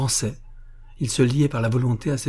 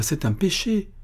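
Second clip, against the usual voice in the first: high, hushed frightened protest; 9 semitones higher than usual. A narrator's reading. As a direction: recoil in fear. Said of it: "dit Lydie avec effroi".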